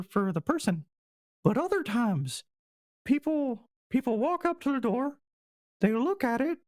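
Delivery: high, squeaky mouse voice, haltingly explaining; dim little mouse. Acted. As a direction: pipe up as a simple-minded mouse.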